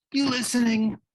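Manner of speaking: high, loud challenging question; sharp demand. Acted. Catcher demands pitcher's attention.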